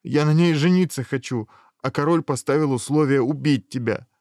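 high, loud complaint; insistent protest. A narrator's reading. Hero explaining the king's condition to dragon, insistent. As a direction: press the case loudly.

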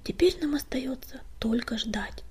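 quiet breathy whisper. A narrator's reading. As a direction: hush it, barely voiced. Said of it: "шепнула Златошейка".

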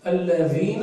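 "الذين" with a voiced th, "alladhina"; tongue between the teeth for the dh in الذين; correct dhal. Shown as a demonstration. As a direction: clear dh in الذين, as in English "this". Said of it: "لأن هنا الذال".

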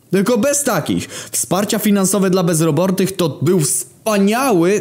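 indignant protest. Acted. Greek indignantly defends benefits.

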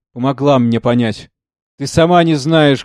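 ordinary delivery

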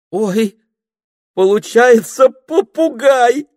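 very high, loud, drawn-out squeal of discovery; delighted. A narrator's reading. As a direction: burst out in sheer delight. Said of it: "Пенелопа была в восторге".

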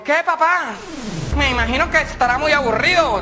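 put-on high, sly girlish voice; teasing daughter. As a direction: needle the father sweetly. Daughter mocking father.

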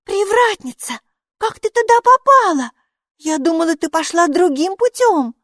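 put-on high, loud girl's voice, happily surprised; delighted to hear her friend; surprised and glad. A narrator's reading. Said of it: "Майки удивилась и обрадовалась". (удивлённо и радостно)

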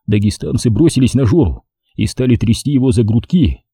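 plain reading voice

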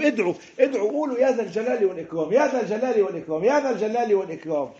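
high, pressing repeated plea; fervent preacher's plea; urgent call. Retold or imitated. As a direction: urge them to call out again and again. Preacher voicing Prophet's urgent call.

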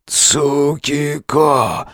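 very slow, strained call; weak croak. Acted. Wounded man tugs at hero's arm, straining. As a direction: drag the name out with effort.

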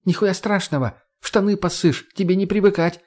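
high, taunting jeer; mocking. A narrator's reading. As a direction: sneer it across the room. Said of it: "насмешливый голос Марго". (насмешливо)

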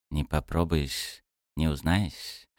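slow, soft and indifferent; calm goddess voice. A narrator's reading. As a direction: shrug it off quietly. Goddess shrugs off question.

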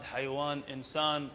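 ordinary talk

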